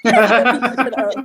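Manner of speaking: slow and loud, breaking into laughter; laughing. Real, not acted. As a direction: laugh the words out as you repeat them. Repeats it, cracking up.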